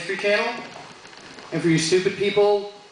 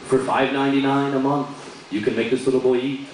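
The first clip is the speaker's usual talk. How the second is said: mock ad voiceover; 7 semitones lower than usual. Charity commercial voiceover parody.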